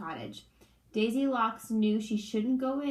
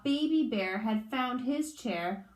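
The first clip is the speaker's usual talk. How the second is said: ordinary delivery, nothing marked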